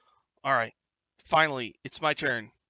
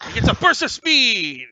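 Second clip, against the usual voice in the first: loud, high burst of excitement; high-energy game master exclamation; excited call-out; 8 semitones higher, 12 dB louder than usual. Acted. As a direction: belt it out like a big action moment. Game master calls out the action.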